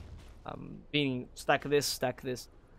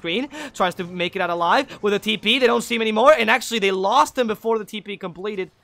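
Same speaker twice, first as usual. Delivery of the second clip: loud, higher-pitched esports commentary; excited play-by-play; 4 semitones higher, 8 dB louder than usual. Real, not acted. Caster calling a teleport escape attempt, excited play-by-play. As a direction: build steadily as the escape unfolds.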